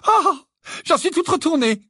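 a proud mother close to tears; mother choked up with pride, high; overcome with emotion. A narrator's reading. Renifla bruyamment.

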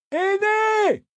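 very high, drawn-out shout of a name; desperate calling. A narrator's reading. Traveller swept away from the girl, desperate calling. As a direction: call the name out long across the river.

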